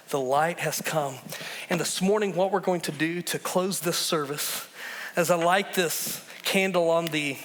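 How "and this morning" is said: usual speaking voice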